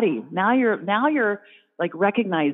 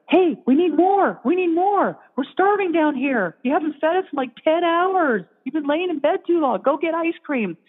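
little creatures whining to be fed; hungry critters; 8 semitones higher than usual. Retold or imitated. Gut critters begging the woman for ice cream, high and whiny.

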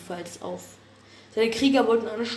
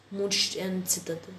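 plain reading voice

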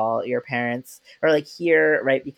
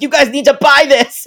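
much higher and louder, bursting with excitement; excited urging; 13 semitones higher, 12 dB louder than usual. Retold or imitated. Urging the other parents to buy the book, excited and loud. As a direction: shout it out like you can't contain yourself.

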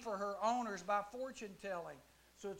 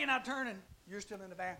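ordinary delivery

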